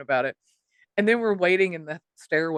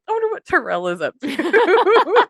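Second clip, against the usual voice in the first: high, loud mock curiosity breaking into laughter; playful wondering; 11 semitones higher, 7 dB louder than usual. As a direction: muse aloud as a running joke. Retold running joke, mock wondering.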